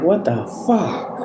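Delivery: slow puzzled what the fuck, rising at the end; confused people asking; baffled question. Retold or imitated. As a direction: ask it confused, voice going up. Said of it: "Some people is like".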